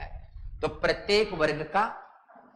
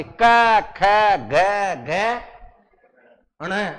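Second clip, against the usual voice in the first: loud recitation; 6 dB louder, much slower than usual. Shown as a demonstration. Teacher loudly reciting letters to class. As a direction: call out क, ख, ग, घ loudly, one by one.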